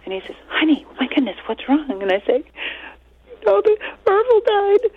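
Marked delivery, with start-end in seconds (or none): 0.5-2.0 high-pitched concerned husband; mock worry, pitched up; worried husband. Husband finds her crying. 3.5-4.9 very high mock-tearful wail; squeaky-high hammed-up grief; retold crying. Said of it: "I'm just crying".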